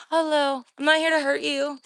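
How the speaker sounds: loud, friendly call across the woods. Real, not acted. Calling out to the creature to calm it, loud. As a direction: call to it that you mean no harm.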